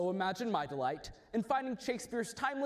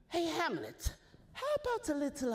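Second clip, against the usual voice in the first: much higher, fast, falsely friendly; mock sweet; 8 semitones higher than usual. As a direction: sweeten it like a trap. Sly invitation to fence.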